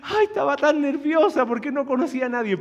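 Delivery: high-pitched imitation of a weeping aunt; mock-tearful outpouring of nerves; tearful relief. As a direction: retell it as a high, emotional wail. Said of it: "y lloraba y lloraba".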